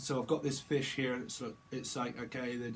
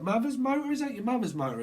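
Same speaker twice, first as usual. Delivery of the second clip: much higher, incredulous mother's motor; amazed disbelief; 10 semitones higher, 5 dB louder, faster than usual. Pretend. Friend amazed at the mother's motor. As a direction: gasp at it in disbelief.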